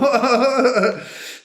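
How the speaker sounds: high, rising-out burst of laughter; big amused laugh; hearty laugh. Real, not acted. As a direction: laugh out loud as you wrap up. Laughs while wrapping up the video.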